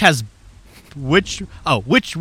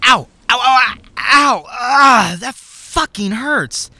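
high, loud cries of pain; pained yelling; 8 semitones higher, 4 dB louder than usual. Acted. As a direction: howl like you were just shot. Shot in the crotch.

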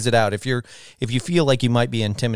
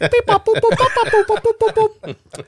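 loud high laugh; 3 dB louder than usual. Real, not acted. Big laugh.

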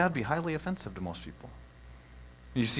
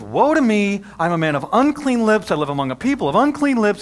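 anguished cry; 7 semitones higher, 11 dB louder than usual. Acted. Preacher voicing Isaiah's lament.

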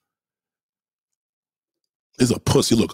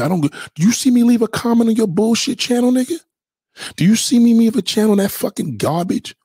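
higher, louder heated ranting; 12 semitones higher, 4 dB louder than usual. Real, not acted. Ranting at a rival YouTuber, loud and heated. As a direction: hammer the questions home, heated.

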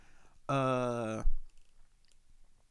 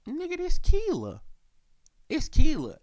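much higher, sudden recognition; high realization; 4 dB louder than usual. Retold or imitated. Realizing who texted, high excited recognition. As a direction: it clicks, pitch shoots up. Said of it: "So then it hit me".

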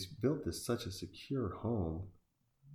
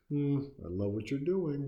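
higher and louder, sarcastic praise; mock praise; 8 semitones higher, 5 dB louder than usual. Real, not acted. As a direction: gush fake approval at the screen. Mocking the film character.

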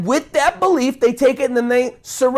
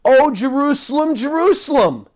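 a higher, lightly voiced lament; mild mournful plea in a raised voice; Jesus lamenting; 7 semitones higher, 3 dB louder than usual. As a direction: call out to Jerusalem in sorrowful longing.

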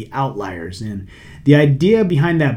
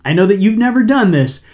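dry teasing tone, raised pitch and volume; sarcastic; 6 semitones higher, 4 dB louder than usual. Acted. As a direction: say it with a knowing wink. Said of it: "I'm just being sarcastic here".

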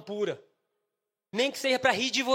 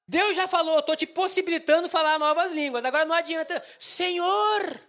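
loud, high preacher's cry; mock pleading shout; 5 semitones higher, 5 dB louder than usual. Preacher mocking those who only pray, loud pleading shout. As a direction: cry out as if praying at full force. Mimics crying out to God instead of studying.

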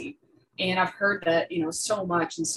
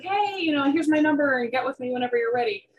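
higher, lightly voiced cheerful host; friendly offer; 8 semitones higher, 3 dB louder than usual. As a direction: hand over your number with a cheerful lilt. Quoting Savannah handing over number.

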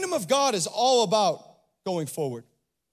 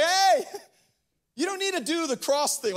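brash Peter; 6 semitones higher than usual. Retold or imitated. Peter voiced pleading with Jesus.

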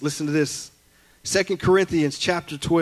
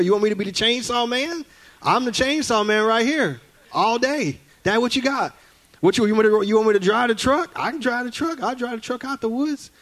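put-on higher, eager kid voice listing what he can do; eager boy offering; 6 semitones higher than usual. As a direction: sell yourself to dad like an eager kid. Boy eagerly offering to do any job.

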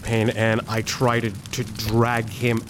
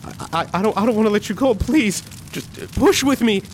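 high, breaking voice begging; cling on, pleading not to let go; desperate plea; 10 semitones higher than usual. Acted. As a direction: Leo begging his dying mentor to push. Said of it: "I'm like".

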